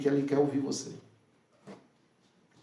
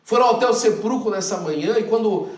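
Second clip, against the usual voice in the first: emphatic; 4 semitones higher, 6 dB louder than usual. Retold or imitated. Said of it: "ele usa uma expressão".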